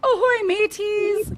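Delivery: loud, high pirate hail. Acted. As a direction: bellow it like a pirate captain. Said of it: "celebrate Talk Like a Pirate Day".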